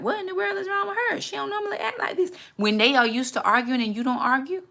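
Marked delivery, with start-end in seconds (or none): 0.0-2.3 high, quick puzzled voice; baffled spouse. Retold or imitated. Confused husband puzzling over his calm wife, high and bewildered. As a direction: wonder aloud what's gotten into her. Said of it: "They're like".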